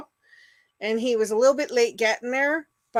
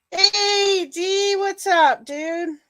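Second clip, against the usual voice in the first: excited greeting; 10 semitones higher, 5 dB louder, much slower than usual. Real, not acted. Greeting a viewer in chat.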